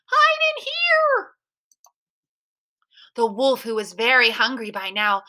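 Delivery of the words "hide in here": very high, slow piglet call; little pig's cry. A narrator's reading. Third little pig calls his brothers inside, crying out. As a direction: squeal it, stretching the last word. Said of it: "cried the third little pig".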